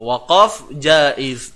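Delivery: teacher pronouncing the term slowly; slow drawn-out. Shown as a demonstration. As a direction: slowly spell out Waqaf jaiz. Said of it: "Namanya apa".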